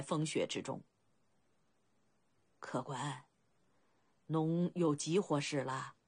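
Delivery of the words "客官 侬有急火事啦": put-on low-pitched elderly boatman; 苍老的声音. A narrator's reading. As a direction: speak low, as a weathered old man. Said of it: "一个苍老的声音". (低沉苍老的老船夫口吻)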